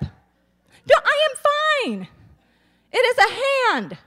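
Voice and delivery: high, chirpy mock outburst; impersonating mother. Mother cutting off her hand, chirping mild curses. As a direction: play a prim mom who won't swear. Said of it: "in denial at all times".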